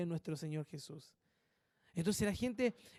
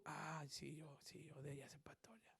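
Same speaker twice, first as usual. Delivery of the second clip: whisper; 5 semitones lower, 21 dB quieter than usual. Retold or imitated. Flattered believer's inner voice.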